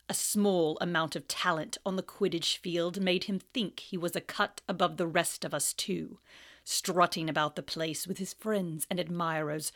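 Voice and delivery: low, cold, mocking teacher; sneering Snape. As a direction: drip with contempt.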